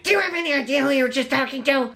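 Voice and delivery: imitating the teacher, high and rushed; frantic teacher. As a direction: rush up to her in a panic. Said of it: "she was like".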